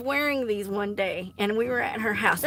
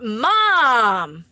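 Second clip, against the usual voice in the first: calling out; 9 dB louder than usual. Real, not acted. Embarrassed, calling out to mom.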